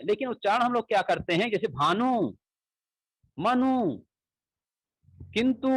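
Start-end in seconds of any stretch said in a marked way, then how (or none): 1.5-5.7 भानु मनु किंतु with the final u stretched long; long u ending. Shown as a demonstration. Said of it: "वहां पे ज्यादा समय ले रहे हैं". (अंतिम उ को लंबा खींचकर)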